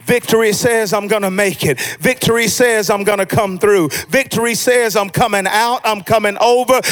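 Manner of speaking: rhythmic sermon build; insistent pulpit cadence, gathering force; full-force preaching. Real, not acted. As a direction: drive each declaration home to the congregation.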